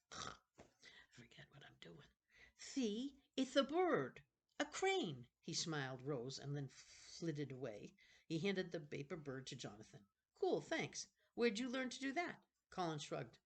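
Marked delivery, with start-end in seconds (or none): 0.1-2.1 slow whispered aside; soft slow whisper. Real, not acted. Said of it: "unvoiced whisper". 2.6-5.3 put-on higher, eager young boy showing his bird; a boy proud of his paper crane; lightly raised young boy voice; boy's voice. Colin voiced, child figure. 10.4-12.4 put-on boy's voice. Jonathan voiced, boy.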